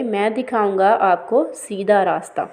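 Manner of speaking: usual speaking voice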